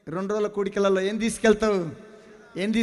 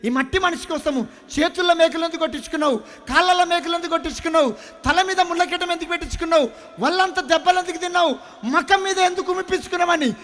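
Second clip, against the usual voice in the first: pleading prayer, preached; 8 semitones higher, 7 dB louder, faster than usual. Acted. Retold tearful prayer, preached loud.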